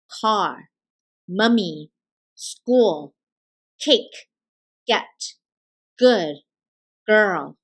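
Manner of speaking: usual speaking voice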